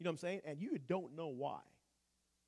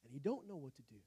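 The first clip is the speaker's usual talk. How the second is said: ordinary talk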